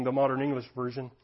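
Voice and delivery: ordinary talk, nothing marked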